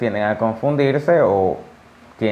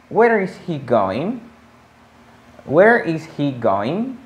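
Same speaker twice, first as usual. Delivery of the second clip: slow model question; 4 semitones higher, slower than usual. Shown as a demonstration. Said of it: "teacher models question".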